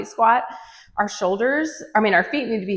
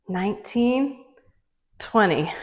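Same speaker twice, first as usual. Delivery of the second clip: ordinary talk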